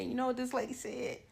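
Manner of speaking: normal talking voice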